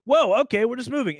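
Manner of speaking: high, loud startled whoa; surprised whoa. Retold or imitated. Cartoon fan voicing brain's surprise, startled. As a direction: caught off guard by the jump. Reacting to sudden time jump.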